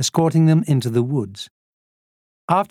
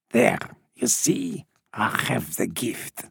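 satisfied; 4 semitones lower, 7 dB quieter than usual. A narrator's reading. Old smuggler proving his gift to Lisa, quietly satisfied. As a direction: let it settle low and smug.

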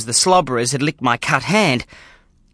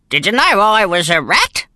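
put-on old grandfather voice; 6 semitones higher than usual. Gramps voiced in the story.

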